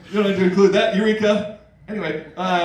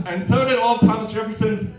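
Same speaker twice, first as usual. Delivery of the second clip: normal talking voice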